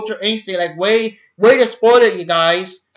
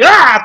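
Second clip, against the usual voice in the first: loud, high mock shriek; startled scream; 7 semitones higher, 8 dB louder than usual. Mother shocked by the TV, loud imitated scream.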